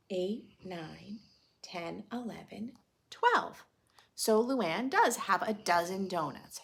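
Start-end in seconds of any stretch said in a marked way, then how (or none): 3.1-3.6 last number jumps high and loud; triumphant count. Acted. Teacher counting donuts with kids, triumphant. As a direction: land the final number with a flourish.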